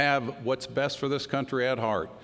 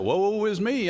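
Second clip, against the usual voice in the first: mock lament; 7 semitones higher, slower than usual. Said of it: "I even said that".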